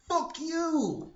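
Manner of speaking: high, loud outburst; furious shout. Acted. Gamer answering Mass Effect three's ending, furious shout. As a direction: yell it in exaggerated outrage.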